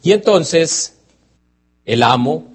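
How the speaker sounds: ordinary talk, nothing marked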